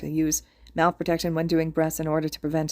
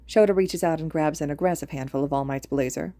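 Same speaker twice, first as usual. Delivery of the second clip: usual speaking voice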